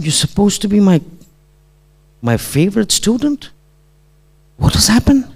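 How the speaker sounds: high, halting, rising questions; retold disbelief. Teacher shocked by his favorite student, pained disbelief.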